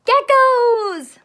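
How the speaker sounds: high, loud gleeful call; loud thrilled title shout; excited shout. Acted. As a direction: announce it with delight.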